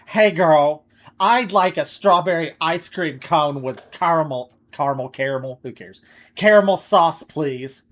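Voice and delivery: put-on loud, bubbly Valley Girl voice. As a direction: play a ditzy Valley Girl customer. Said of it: "Valley Girl or something".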